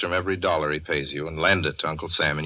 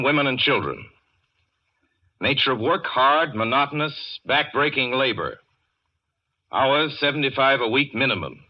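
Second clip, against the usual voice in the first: higher, brisk classified-ad readout; mock want ad; 4 semitones higher than usual. Welles reading a fake Nazi want ad, brisk higher ad voice. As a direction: rattle it off like a grim newspaper ad. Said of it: "a want ad like that".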